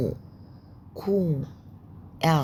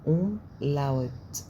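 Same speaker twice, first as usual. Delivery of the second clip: أوملاوت spelled out slowly syllable by syllable; slow umlaut; much slower than usual. Shown as a demonstration. Teacher slowly teaching the word to learners. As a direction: stretch أوملاوت out. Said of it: "اسمهم أوملاوت".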